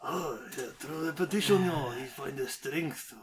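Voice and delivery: lightly mimicked sage master; wise mentor voice. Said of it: "Okay, Mister Miyagi".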